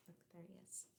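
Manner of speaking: very soft whispered aside; hushed aside. Acted. Narrator whispering to listeners as Antonio appears. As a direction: breathe it like a secret.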